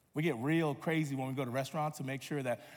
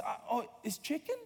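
put-on high, softer child voice, hesitant question; dismayed little girl; 6 semitones higher, 5 dB quieter than usual. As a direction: imitate a child taken aback. Daughter learns nugget is chicken.

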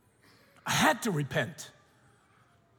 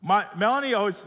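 normal talking voice

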